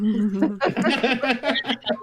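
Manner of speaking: slow, laughing disbelief. Real, not acted. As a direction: laugh while asking. Laughing at own slip.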